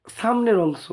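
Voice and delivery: usual speaking voice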